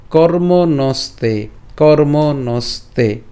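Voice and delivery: কর্মণস্তে very slow, syllable by syllable; slow repeated model of কর্মণস্তে; pronunciation demo. Shown as a demonstration. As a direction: stretch কর্মণস্তে and hold the final e. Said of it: "উচ্চারণটা কেমন হবে".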